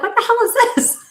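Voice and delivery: high, loud shocked squawk; shrieking mock outrage; outraged disbelief. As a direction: react as if insulted by the bottle. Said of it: "I'm like".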